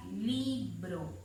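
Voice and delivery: "libro" slowly, LI drawn out; "libro" split in two, weight on LI; stress on first syllable. Shown as a demonstration. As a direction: lean the voice on LI in "libro". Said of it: "dónde está la fuerza de voz".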